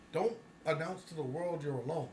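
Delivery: ordinary talk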